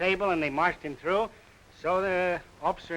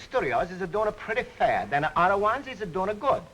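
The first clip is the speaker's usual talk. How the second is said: put-on comic Greek accent; 2 semitones higher than usual. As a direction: play a jolly Greek immigrant pitching the slogan. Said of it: "how about a little example of that dialect you talk of".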